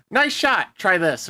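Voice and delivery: high, punchy action-hero one-liner; witty retort. Acted. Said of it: "Some witty line like that".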